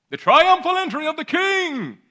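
very high, booming heralding cry; hammed-up triumphant announcement; grand proclamation. Mock-proclaims the Bible heading.

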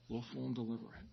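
soft, dropped repeat of willful and deliberate; quiet. Shown as a demonstration. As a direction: let the repeat fall quiet.

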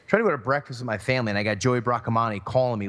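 ordinary talk

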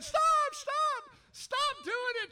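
high, loud cry of stop; frantic shout. Acted. Paul shouting at the church to stop, high and loud. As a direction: yell at them to quit it.

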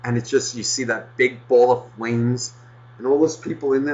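ordinary talk, nothing marked

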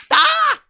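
sharp cry of pain; pained yelp. Acted. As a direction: yelp as if hurt.

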